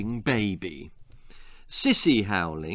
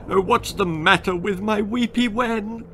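tearful, high blubbering; a father weeping over his girl; weepy father; 8 semitones higher than usual. Acted. Father weeping over his daughter, tearful. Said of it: "wept her father".

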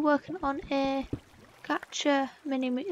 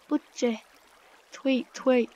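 budgie tweets; 4 dB louder, slower than usual. Acted. Imitating pet budgie.

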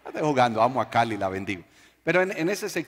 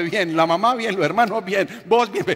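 mock recap; 6 semitones higher, 5 dB louder than usual. Mocking the short answers.

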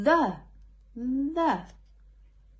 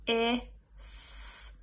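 ordinary talk, nothing marked

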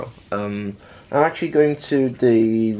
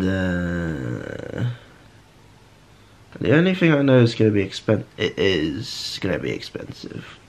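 ordinary delivery

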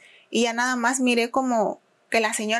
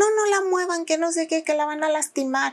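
alarmed accomplice; 5 semitones higher than usual. Retold or imitated. Accomplice shouting don't move her.

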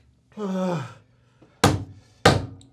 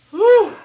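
pained whew; 12 dB louder than usual. Real, not acted. Burning mouth from hot pepper.

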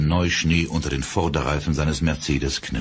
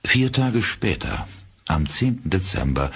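ordinary delivery

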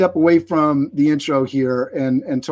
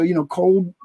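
normal talking voice

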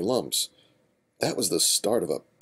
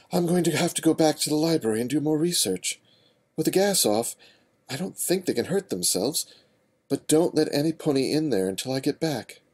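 put-on higher, urgent young female voice; young mare voice; 8 semitones higher than usual. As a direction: take charge, a little frantic. Twilight gives orders.

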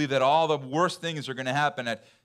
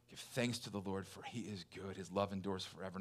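soft, intimate, much quieter refrain; hushed scripture refrain; 6 semitones lower, 14 dB quieter than usual. Real, not acted. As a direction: let the verse sink in, almost murmured. Softly repeating scripture refrain.

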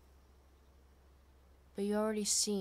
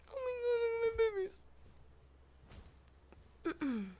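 gushing; 10 semitones higher, 3 dB quieter, much faster than usual. Real, not acted. Gushing over creatures.

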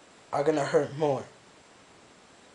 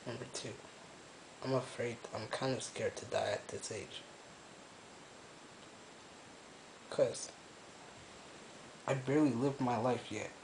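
hushed and hopeless, barely above a murmur; quiet, broken confession; quiet despair; 3 semitones lower, 16 dB quieter than usual. Real, not acted. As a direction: speak low like you have given up. Quiet despairing confession alone in room.

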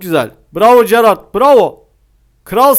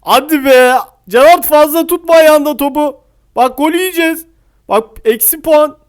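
anxious urging; 7 semitones higher, 4 dB louder than usual. Real, not acted. Urging and scolding player.

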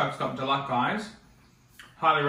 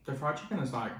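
chewing while talking; talking between bites; mouth full; 7 dB quieter than usual. Real, not acted. Seen 0: Holds sandwich at mouth, cheeks working between bites while talking.

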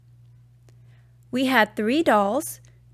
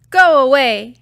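put-on louder, cross child's voice; sulky loud go away; angry dismissal; 8 dB louder than usual. A narrator's reading. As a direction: push him away with the words. Angry friend after toy breaks.

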